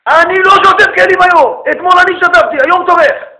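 high, loud screamed demand; man screaming. Retold or imitated. Husband screams at wife about dishes. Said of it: "צרחה, אני אומר, צרחה".

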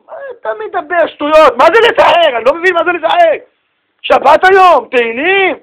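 high, insistent reprimand; scolding father. Acted. Father insists son go read Psalms, scolding. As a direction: order the child off, brooking no argument.

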